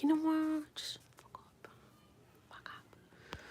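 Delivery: whispered acted swearing; muttered quarrel aside. Partner cursing the other during back-and-forth, muttered whisper. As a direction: mutter it under the breath.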